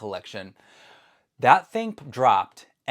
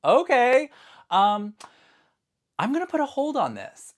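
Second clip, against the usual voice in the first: mock resigned; 13 semitones higher, 6 dB louder than usual. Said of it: "I was like".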